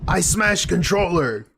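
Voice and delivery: caveman voice. Retold or imitated. Echoing a caveman donation message.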